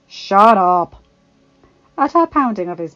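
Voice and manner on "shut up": loud gruff command; gruff retort. A narrator's reading. The Hound silencing Arya on his shoulder, gruff. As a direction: cut her off gruffly and loudly.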